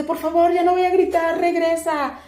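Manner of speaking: high, urgent retold begging; pleading. Labouring mother begs attendant to return, high urgent plea. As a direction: imitate calling someone back, pitched well up.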